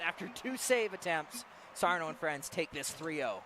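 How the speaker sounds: lively, driving esports commentary; live play-by-play. Acted. Caster calls the last seconds of the match, live play-by-play. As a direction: call the match with rising urgency.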